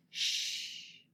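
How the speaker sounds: ordinary delivery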